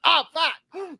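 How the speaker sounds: high-pitched cartoonish yelp; Cartman. Friend imitating man whose hand got slapped off, Cartman voice. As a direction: squeal it like an indignant cartoon kid. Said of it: "this guy sounded and looked like Cartman".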